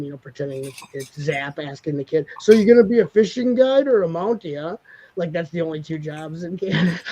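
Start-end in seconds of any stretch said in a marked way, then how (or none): 2.4-4.8 higher, louder hammed-up Canadian guy; Zap's mock Canadian voice. Friends imitating Zap quizzing the kid, hammed-up Canadian voice. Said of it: "they do the voice for him".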